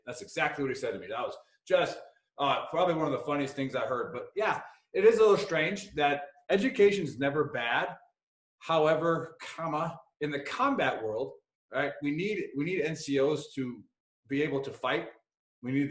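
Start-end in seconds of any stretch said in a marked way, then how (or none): none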